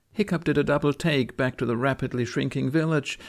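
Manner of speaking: ordinary delivery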